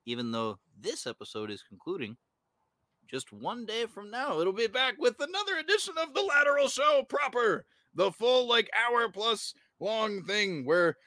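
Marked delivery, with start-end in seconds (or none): 3.1-11.0 rising excited announcer voice; hyped promo. Real, not acted. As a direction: build the hype for the show. Hyping the next episode.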